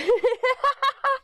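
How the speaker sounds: high-pitched burst of laughter. Real, not acted. Finding a shop rag instead of a tissue, laughing. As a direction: break into a high, quick cackle.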